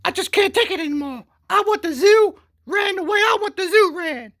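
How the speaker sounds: very high mock woman's voice, loud; falsetto woman. Wife complaining about the zoo, in a high falsetto. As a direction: squeal it like a whiny zookeeper wife. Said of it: "and she's like".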